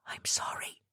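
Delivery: breathy, voiceless apology; whisper. Acted. Abby whispering an apology to Lucky, voiceless. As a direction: whisper it, shaken and ashamed. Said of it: "Abby whispered".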